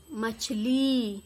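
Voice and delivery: slow, drawn-out मछली for dictation; dictation word. Shown as a demonstration. Teacher dictating मछली slowly to pupils. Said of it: "क्या बोला मैंने".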